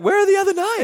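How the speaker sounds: "Where are the other nine?" thrown out high and loud, full of laughter; a playful, delighted ask, pitched high; laughing, rejoicing. Pretend. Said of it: "kinda laughing". (Jesus laughing with the healed man, rejoicing)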